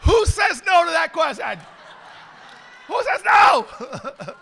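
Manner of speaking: shouting it out in laughing disbelief; laughing, disbelieving yell; incredulous shout. Retold or imitated. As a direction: bellow it like it's obvious, laughing. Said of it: "I'm like".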